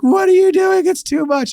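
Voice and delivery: very high, loud shocked squeal; squealed protest. Retold or imitated. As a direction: squeal her disbelief. Cashier protests again.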